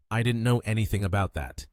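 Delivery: ordinary delivery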